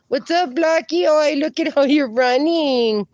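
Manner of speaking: high, delighted cooing; excited greeting. Real, not acted. Streamer spots Blackie running, excited high-pitched greeting.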